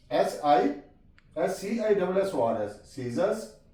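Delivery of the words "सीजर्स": सीजर्स said softly with a long s and a rising end; soft model word. Shown as a demonstration. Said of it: "यहाँ पे भी सी silent है". (सीजर्स धीमी आवाज़ में)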